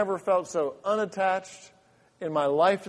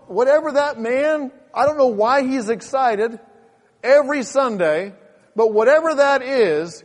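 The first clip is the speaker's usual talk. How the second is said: loud, raised-pitch preacher voicing a doubter; impassioned preaching; 7 semitones higher, 8 dB louder than usual. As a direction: preach it forcefully, airing the churchgoer's frank confession. Preaches at full force.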